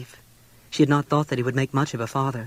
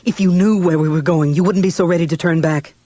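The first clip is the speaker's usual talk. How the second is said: tormented outburst; 3 semitones higher, 7 dB louder than usual. A narrator's reading. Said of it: "burst out, tormented past endurance".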